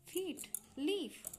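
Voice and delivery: "फीट, लीफ" slowly, long ई held; long vowel demo. Shown as a demonstration. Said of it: "लेकिन long sound क्या होता है".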